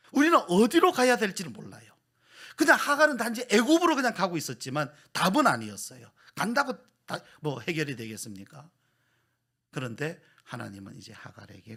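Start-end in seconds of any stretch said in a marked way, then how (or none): 0.1-8.7 loud, high, impassioned sermon delivery; preaching at full force. Acted. Pastor pressing the congregation, loud and impassioned. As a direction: drive the point home to the pews. Pastor proclaims God's guidance.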